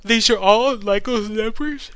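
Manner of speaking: slow question through a real yawn. Real, not acted. Tired host asks about the numbers mid-yawn. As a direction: say These are all Lego's numbers? while yawning. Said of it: "I'm yawning".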